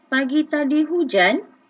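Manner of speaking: sung-out question intonation; questioning rise. Shown as a demonstration. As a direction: lift the end like a real question. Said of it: "intonasi suara kita pun, okey, ada lenggok dia".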